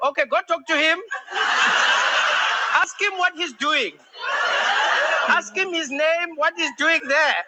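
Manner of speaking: high, exasperated South African dispatcher; South African operator. Acted. Operator tells nervous caller what to do, high-pitched South African operator. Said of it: "if you had South African operators".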